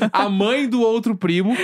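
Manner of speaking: usual speaking voice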